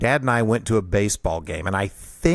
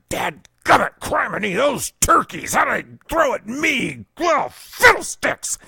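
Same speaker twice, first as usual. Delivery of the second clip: dad's sputtering cusses; 13 semitones higher than usual. Retold or imitated. Dad chasing the kids, fuming in mock-sputtering euphemisms. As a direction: sputter fake curse words in mock outrage. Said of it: "I just hear him under his breath".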